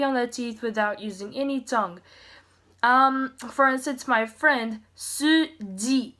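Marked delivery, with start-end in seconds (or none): 4.1-6.1 Suji said slowly with a D-like j, sudi; Korean Suji. Shown as a demonstration. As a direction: Suji with the j tapped close to the teeth. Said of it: "not Suji".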